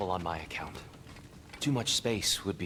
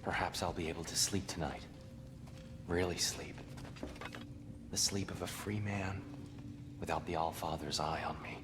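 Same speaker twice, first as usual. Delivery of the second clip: softer, tired, last words almost whispered; weary quiet; 2 semitones lower, 7 dB quieter than usual. Acted. Tyr alone in the closet at night, softly relieved, almost whispering. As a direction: let the relief sink into a hush.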